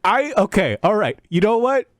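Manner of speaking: normal talking voice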